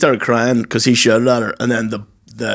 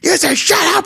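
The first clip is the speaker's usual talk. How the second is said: much higher, squeaky mimic of Dave; mock squeaky voice. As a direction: squeak it out way up high, mocking. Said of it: "Dave was like".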